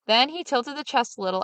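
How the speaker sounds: ordinary delivery, nothing marked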